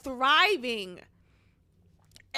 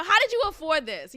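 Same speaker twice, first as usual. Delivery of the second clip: louder, fast disbelieving question; louder disbelief; 5 semitones higher, 7 dB louder than usual. Retold or imitated. Asking her peers, loud disbelief. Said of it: "Oh my God".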